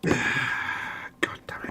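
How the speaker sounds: slow whispered curse. Real, not acted. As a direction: breathe the curse out slowly. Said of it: "stop whinging".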